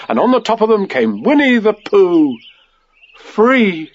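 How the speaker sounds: high, rising storyteller excitement; triumphant climax. A narrator's reading. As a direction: build to a joyful announcement. Climax: Pooh pops free.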